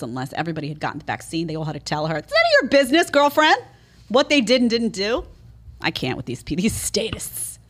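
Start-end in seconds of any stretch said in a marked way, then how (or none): 2.2-5.2 mock sassy retort. Mocking the dentist's staff.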